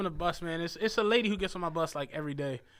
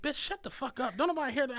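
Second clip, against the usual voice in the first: higher mock snap, imitating the angry rider; retold snapping; 7 semitones higher, faster than usual. Bus driver retelling how a rider snapped at the nice lady, mock angry snap. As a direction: replay her outburst in a lightly put-on voice. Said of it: "this lady just snapped on her".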